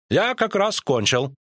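high, drawn-out character voice; slow, high-voiced courtier; courtly figure's reply. A narrator's reading. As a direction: finish ceremoniously, pitched high and slow. Куткудак's reply, voiced by narrator.

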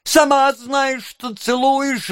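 high-pitched, insistent objection; whiny retort. A narrator's reading. Noah contradicting Charlotte's reproach, whiny retort. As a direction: argue back in a high whine. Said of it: "возразил Ноэ".

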